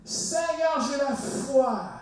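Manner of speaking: high, slow proclamation of faith; confident declaration. Acted. Believer speaking to the Lord before circumstances return, confident. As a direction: declare it boldly, drawing it out. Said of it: "Et les circonstances te reviennent à l'esprit".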